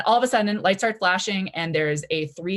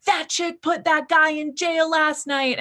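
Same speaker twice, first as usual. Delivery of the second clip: higher, slower, put-on gossipy voice; gossiping student; 10 semitones higher, slower than usual. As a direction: drag it out like a scandalized onlooker. Gossiping student in dining hall.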